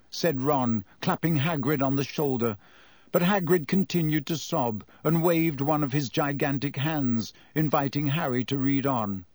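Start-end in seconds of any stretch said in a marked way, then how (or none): none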